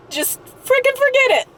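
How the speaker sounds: high-pitched exasperated outburst; fed up. Real, not acted. Patient fed up with doctors judging weight, exasperated. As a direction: give up in frustration, pitch shooting up. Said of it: "I've seriously had enough".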